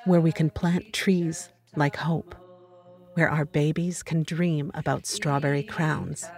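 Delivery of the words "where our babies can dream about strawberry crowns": plain reading voice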